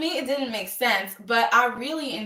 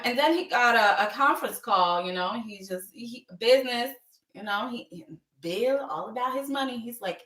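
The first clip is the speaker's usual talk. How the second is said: ordinary delivery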